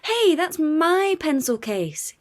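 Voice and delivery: put-on yelling child. A narrator's reading. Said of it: "yells Bingo".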